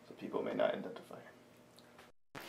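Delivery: hushed aside; whisper. Acted. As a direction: drop to a whisper. Unvoiced aside.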